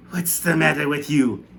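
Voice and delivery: irritated Aunt Sponge; demanding aunt. A narrator's reading. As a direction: snap at her sister. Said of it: "Aunt Sponge demanded".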